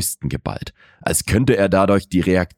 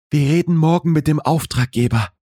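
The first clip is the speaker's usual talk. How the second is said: much higher, composed female character voice; smooth, professional woman; 11 semitones higher, faster than usual. A narrator's reading. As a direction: state it with cool business formality, pitched up. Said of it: "Ihre Stimme war wieder glatt, professionell". (glatte, professionelle, hohe Frauenstimme)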